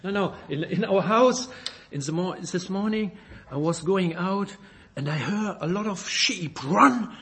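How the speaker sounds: ordinary delivery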